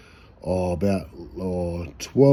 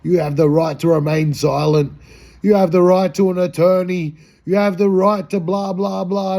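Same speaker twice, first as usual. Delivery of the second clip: lightly mocking cop, higher and louder; cop reading rights; 7 semitones higher, 4 dB louder than usual. Cop reading him his Miranda rights, mock officious. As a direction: rattle off the rights like a bored cop. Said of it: "reading me my Miranda rights".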